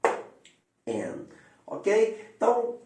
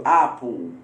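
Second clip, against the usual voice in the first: British apple; 5 semitones lower, 4 dB louder than usual. Shown as a demonstration. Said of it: "ou como britânico".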